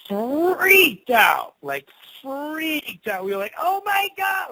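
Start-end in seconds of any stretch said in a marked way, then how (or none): 0.1-3.2 slow, high, stretched "freaked"; dramatic drawn-out emphasis. Retold or imitated. Telling how they found out about the house, dramatic stretched emphasis. Storyteller dramatizes the shock of discovery. 3.6-4.5 very high, squealed mock panic; mock freak-out. Two kids from Cleveland learn the truth, mock freak-out. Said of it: "just freaking out".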